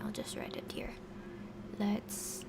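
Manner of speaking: whispering. Real, not acted. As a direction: drop to a whisper. Aside before writing.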